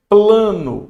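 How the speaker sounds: slow, loud "plano"; stressed consonant cluster. Shown as a demonstration. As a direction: lean on the P-L opening of "plano". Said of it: "se você diz assim".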